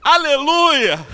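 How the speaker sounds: high, loud, drawn-out shout; long belted one-word answer; answering holler. Retold or imitated. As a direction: shout back that you have understood. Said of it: "O cara olhou assim".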